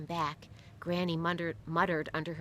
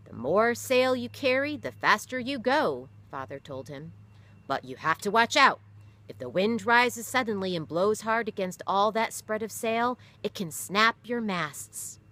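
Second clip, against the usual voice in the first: a firmer, louder father explaining sail; father's voice; 5 semitones higher, 7 dB louder than usual. Acted. Father teaching his son about sail, a firm voice. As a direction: lean in as the steady father teaching his boy.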